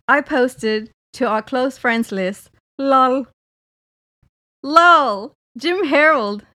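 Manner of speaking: loud, theatrical message readout; exaggerated text-reading voice. Re-enacting a text chat for co-host, hammed-up. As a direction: perform the texts, shouting each lol. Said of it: "in my best Dulce voice".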